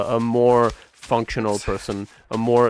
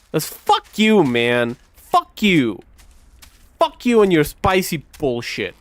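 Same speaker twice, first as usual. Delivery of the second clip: louder and higher, swearing from the gut; real angry outburst; 4 semitones higher, 3 dB louder than usual. Real, not acted. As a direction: let the anger burst out, higher and louder. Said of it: "that came from the soul".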